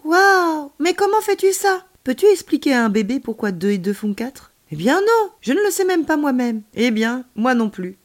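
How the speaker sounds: put-on high, loud childlike voice; piping, astonished kid firing questions; excited child character. A narrator's reading. Child marvelling at the alien's calculations, excited. Child hero's dialogue in story.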